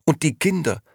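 put-on excited old friend pouring out questions. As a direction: gush it out, barely pausing for breath. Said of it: "redete aufgeregt weiter".

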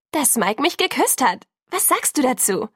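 high, loud, insistent friend; indignant excitement. A narrator's reading. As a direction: demand a reaction to the big news. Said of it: "fragte Jess ungehalten". (aufgeregt und ungehalten)

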